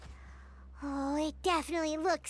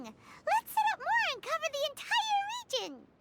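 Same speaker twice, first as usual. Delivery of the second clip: much higher, eager exclamation; excited; 10 semitones higher than usual. Acted. Companion cheers on the purification device, high and excited. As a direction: blurt out the idea with delight.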